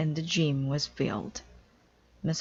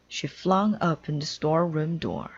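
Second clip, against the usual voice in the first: ordinary delivery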